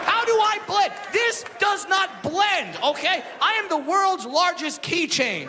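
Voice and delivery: high comic yell, slow on does not blend; exasperated shout. Acted. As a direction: shout it at the crowd in disbelief.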